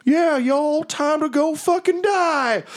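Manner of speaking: high-pitched creature war whoop; war mammoth. Acted. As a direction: holler it as a gung-ho talking mammoth. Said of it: "you hear the mammoth go".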